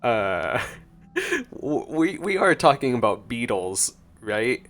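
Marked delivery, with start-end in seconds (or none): none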